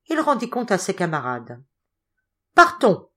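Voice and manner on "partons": high, loud call to leave; shout. A narrator's reading. The men calling to break camp, shouting. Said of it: "Ce fut le cri commun".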